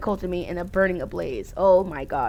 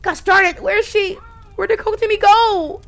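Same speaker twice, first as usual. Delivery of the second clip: worried call; 9 semitones higher, 5 dB louder than usual. Acted. Character searching burning house.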